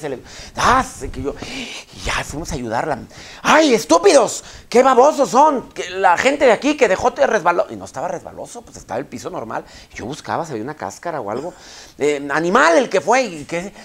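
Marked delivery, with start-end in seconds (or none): 3.4-7.6 mock the lady yelling at the crowd; fallen lady's angry rant. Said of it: "se cae una señora". 12.0-13.2 mock angry yell. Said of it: "se cae una señora".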